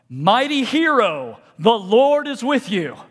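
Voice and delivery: slow, loud, ringing announcement; booming angelic greeting, drawn out; grand angel proclamation. Acted. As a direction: proclaim it like a heavenly herald.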